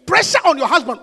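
ordinary delivery

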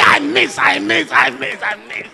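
shouted, high sermon climax; preaching at full force. Real, not acted. Preacher shouting to the congregation, full force. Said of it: "I'm preaching".

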